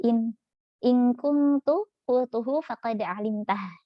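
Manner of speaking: slow, drawn-out recitation of the verse; Quran recitation. Acted. Teacher quoting the prophet's reply, slow recitation. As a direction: let each Arabic word stretch out reverently.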